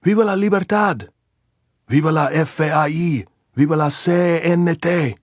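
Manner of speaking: high, loud chanted cheers; shouted slogans. A narrator's reading. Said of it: "they shouted back at him".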